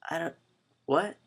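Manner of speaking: put-on high, hushed, halting child; confused young daughter. Daughter asked where the glasses are, imitated confused child. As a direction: imitate a baffled little girl. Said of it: "And she's like".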